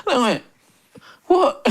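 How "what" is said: shocked what. Retold or imitated. Stunned at being ignored.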